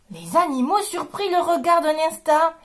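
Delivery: usual speaking voice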